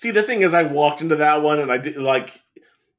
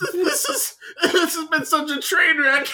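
laughing through words; words breaking up with laughter; 14 semitones higher than usual. Real, not acted. Laughing fit after joke.

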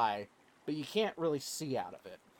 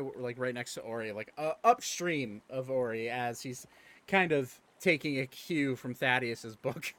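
ordinary talk

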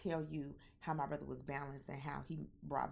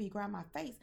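usual speaking voice

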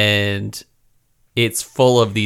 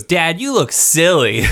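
put-on kid voice; 7 semitones higher, slower than usual. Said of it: "he goes".

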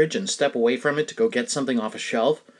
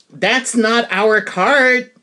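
passerby correction; 6 semitones higher, 4 dB louder, much slower than usual. Retold or imitated. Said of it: "a woman pushing another grocery cart walked by and said".

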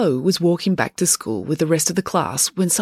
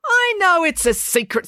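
high-pitched, unhushed retort; exasperated retort; 13 semitones higher, 3 dB louder than usual. A narrator's reading. April answering Joe's warning, exasperated retort. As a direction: throw it back high, not whispered.

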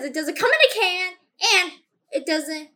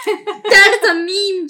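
laughing; 5 semitones higher, 13 dB louder, slower than usual. Real, not acted. Laughing about croissant joke.

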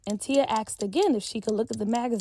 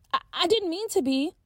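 put-on higher, breezy young girl voice; carefree Peach brushing off her friend, bubbly girl voice; 7 semitones higher than usual. A narrator's reading. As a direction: play Peach carefree and chatty. Peach sheepish.

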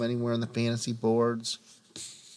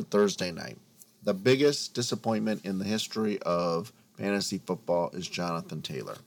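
ordinary delivery

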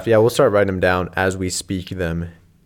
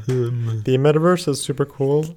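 slow, softer tech-boss impression; Mark Zuckerberg; 2 semitones higher, 5 dB quieter, slower than usual. Shown as a demonstration. Doing an impression on request, slow tech-boss voice. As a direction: recite it slowly like a stiff tech founder. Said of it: "give me a Zuck boy real quick".

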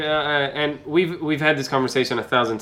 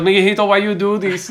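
higher-pitched imitation of a Spanish-accented mother; mother's Spanish accent; 4 semitones higher than usual. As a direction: question your child in a put-on Spanish accent. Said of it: "I don't know why I'm doing the accent".

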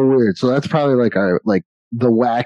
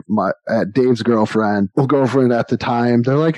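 ordinary talk

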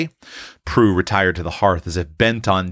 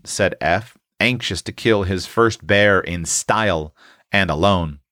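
ordinary delivery, nothing marked

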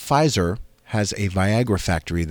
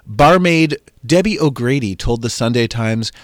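usual speaking voice

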